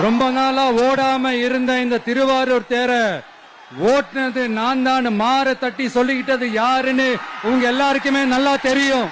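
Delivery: loud campaign oratory over a crowd; rally speech. Real, not acted. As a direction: rouse the Tiruvarur crowd, mocking the CM. Loud rally oration in Tiruvarur. (உரத்த பிரச்சார மேடைப் பேச்சு)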